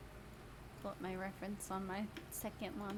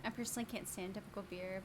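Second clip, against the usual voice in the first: ordinary talk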